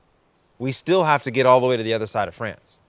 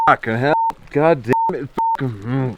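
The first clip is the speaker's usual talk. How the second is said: frustrated cursing; 3 semitones higher, 3 dB louder than usual. Real, not acted. Frustrated rider swears after failing.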